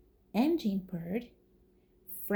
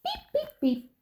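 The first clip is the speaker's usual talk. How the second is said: truck beep. Acted. Reading aloud to kids, Blue's high little beep. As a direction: squeak it like a tiny horn. Horn voiced.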